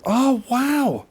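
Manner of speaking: high, wondering "wow"; amazed realisation. Retold or imitated. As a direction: let it dawn on you, pitch lifted. Retells his sudden realisation.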